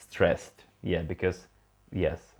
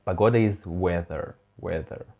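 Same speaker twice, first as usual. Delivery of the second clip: ordinary talk, nothing marked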